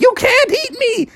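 very high, outraged squeal; Punxsutawney Phil. Acted. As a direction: protest frantically as the hunted groundhog. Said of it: "Punxsutawney's like".

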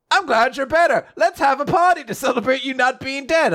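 high, bright townspeople voice; high cheerful townsfolk. Retold or imitated.

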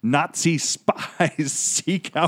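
cracking up mid-read, slow; reading through a laugh. Real, not acted.

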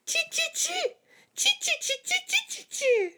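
tiny, very high-pitched monkey squeaks; monkey chatter. A narrator's reading. Monkeys mimicking the scolding peddler, squeaky chatter. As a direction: chatter back like cheeky little monkeys. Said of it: "shook both their hands back at him and said".

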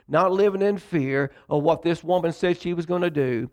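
ordinary talk

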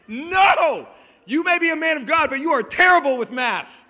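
high, loud comic tirade; exasperated shout. Acted. Servant telling master he's terrible with math, exasperated shout. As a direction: holler in mock exasperation.

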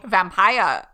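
loud New Zealand vampire; New Zealand accent. Retold or imitated. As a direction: say it like a Kiwi vampire. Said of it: "New Zealand accent".

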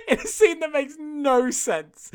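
high-pitched laugh through the words. Real, not acted. Friend cracking up at the film's plot, laughing. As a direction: laugh while saying it.

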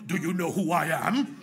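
a touch of a haughty TV Pilate voice; Pilate impression. Preacher lightly imitates TV Pilate's haughty question. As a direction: lightly mimic the Netflix Pilate's proud question. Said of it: "that's how he said it too".

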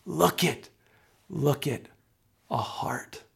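soft, hushed, reverent; simply said. Acted. Pastor in prayer, others noticing a heart, hushed. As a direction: let it fall gently away to nearly a whisper. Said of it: "would just simply say".

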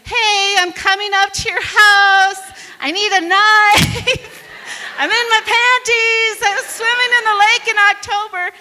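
high, loud call with laughs. Retold or imitated. Shouting to strangers' house from below, loud laughing call.

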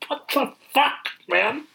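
high-pitched baffled protest; exasperated disbelief. Retold or imitated. Viewer reacting to the eagle flying in, exasperated. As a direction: imitate a dumbfounded viewer.

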